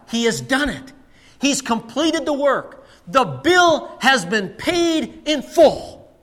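high, slow proclamation; emphatic preaching. Real, not acted. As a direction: preach it out, drawing out each word. Preacher proclaims the finished work.

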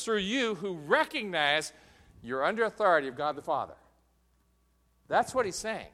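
high, loud, building pulpit delivery; forceful preaching. Real, not acted. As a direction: drive the argument home with rising force.

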